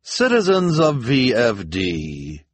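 loud, slow public proclamation; pompous address to the townspeople; grand. A narrator's reading. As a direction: announce it grandly, stretching each word. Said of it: "she said grandly".

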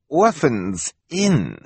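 high, slow, put-on foreign voice; fake broken English. A narrator's reading. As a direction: draw it out like a schemer faking poor English. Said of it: "pretending that he could not speak English properly".

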